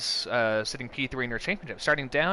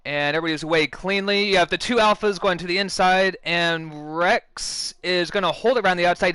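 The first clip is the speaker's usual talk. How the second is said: loud, high, excited play-by-play; motorsport commentator; 6 semitones higher, 7 dB louder than usual. Real, not acted. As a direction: call the opening lap at full pace. Live play-by-play of first corner.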